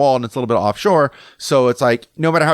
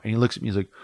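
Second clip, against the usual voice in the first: usual speaking voice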